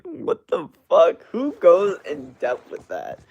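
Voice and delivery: high, slow, loud incredulous reaction; amused disbelief. Real, not acted. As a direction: stretch it out in baffled amazement. Reacting to elaborate chat casting joke.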